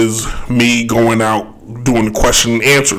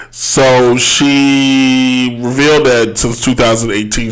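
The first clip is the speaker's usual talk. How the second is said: normal talking voice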